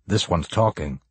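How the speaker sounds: low cop voice, understated; cop character. Acted. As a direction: voice a cop reporting to his partner. Startled cop, narrator voices him.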